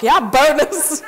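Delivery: slow, loud, laughing "bonus"; gleeful laugh. Retold or imitated. Retelling getting free tickets, slow loud laughing cheer. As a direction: cheer it through a laugh, drawn out. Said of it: "And I'm like".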